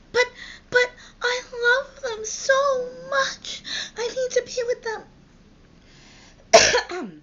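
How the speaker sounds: exaggerated teenage crying. Forbidden teen pleading to parents, wailing in mock tears. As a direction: blubber like a lovesick kid, hammed up. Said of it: "they cry".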